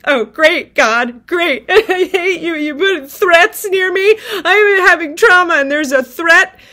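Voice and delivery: laughing through an outraged rant at God; exasperated rant. Retold or imitated. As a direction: throw sarcastic fury at God.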